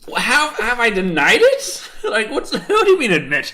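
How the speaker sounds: high, loud, amused indignation; laughing protest. Real, not acted. Teased about a fetish, protests laughing.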